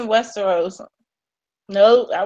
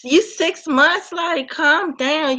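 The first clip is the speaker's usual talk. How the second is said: mock calm down; 5 semitones higher, slower than usual. Mocking the newcomer.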